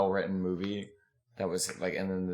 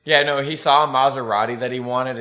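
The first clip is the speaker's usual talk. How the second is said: ordinary talk, nothing marked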